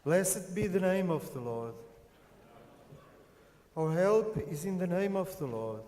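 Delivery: ordinary talk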